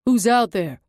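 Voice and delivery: loud call from a distance; a guard shouting into the dark. A narrator's reading. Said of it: "a voice calls".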